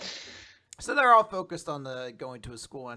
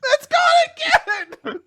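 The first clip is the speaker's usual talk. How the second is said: high, loud, slow wail through laughter; laughing mock wail; 12 dB louder, slower than usual. Retelling the girl's chocolate disappearing, laughing mock wail. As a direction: wail the loss while cracking up.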